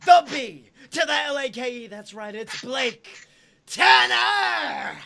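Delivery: loud, booming circus ringmaster. Acted. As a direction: announce it to the big top. Circus intro for host.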